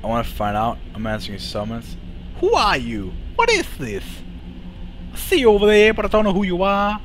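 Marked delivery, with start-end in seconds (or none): 2.4-7.0 playful falsetto questioning; high put-on voice. Player calls to a stranger over there, high put-on voice. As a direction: pitch it way up, mock-mystified. Player greets unknown figure in game.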